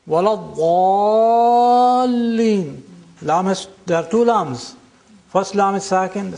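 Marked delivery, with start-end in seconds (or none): 0.1-2.8 "Waladhdallin" slowly, as walad-daaaal-lin; extra lengthening. Shown as a demonstration. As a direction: hold the a before the double l in "Waladhdallin" very long. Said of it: "are followed by a sakin letter".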